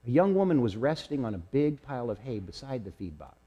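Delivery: plain reading voice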